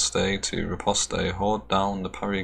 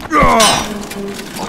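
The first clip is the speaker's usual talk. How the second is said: exasperated wail; 14 semitones higher, 8 dB louder than usual. Real, not acted. Frustrated gamer lost in tutorial.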